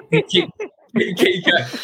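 cracking up mid-sentence; trying to talk through laughter; laughing. Real, not acted.

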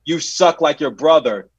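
half-volume re-enacted taunt; retold heckling yell. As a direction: jeer slowly at a player, imitating a yell. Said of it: "I'm yelling from the s-, top of my lungs".

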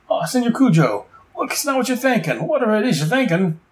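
high panicked Boomerang babbling excuses; panicky pleading crook. Retold or imitated.

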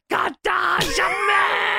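yelled high and drawn out; excited shout. Real, not acted. Narrating the finish, he shouts the hold name high. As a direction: belt out the move name.

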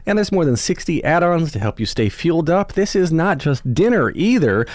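upbeat sponsor read by the host. Acted. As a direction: sell the meals brightly, like a podcast ad.

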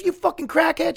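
very high-pitched imitation yell at normal volume; mock screaming. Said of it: "She starts yelling and screaming at her".

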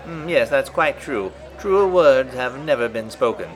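prim agreeing reporter voice; old-time reporter. Pretend. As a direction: sign off the interview in character. Reporter replies in bit.

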